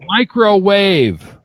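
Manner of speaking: microwave stretched out slowly, every syllable clear; slow careful microwave. Shown as a demonstration. Host drilling the guest on microwave, slow and careful. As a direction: drag out microwave like a lesson. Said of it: "you can say it perfectly".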